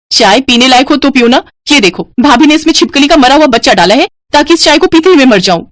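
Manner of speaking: high, outraged daughter; heated, high-pitched tirade; angry accusation. Acted. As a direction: accuse her as you complain to mother. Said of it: "Kavya गुस्से में चिल्लाते हुए कहती है".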